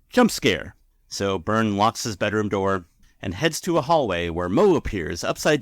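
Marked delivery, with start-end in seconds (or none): none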